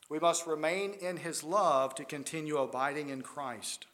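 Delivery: ordinary delivery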